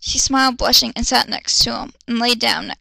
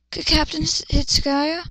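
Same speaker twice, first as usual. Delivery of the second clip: put-on girly voice; 3 semitones higher than usual. Girl character voiced in reading.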